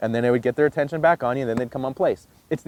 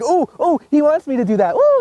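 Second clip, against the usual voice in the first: high, loud eager dog voice; bursting with excitement, high and loud; happy, excited dog; 12 semitones higher, 5 dB louder than usual. Acted. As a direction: voice a thrilled dog, pitched way up. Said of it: "and they get all happy, excited".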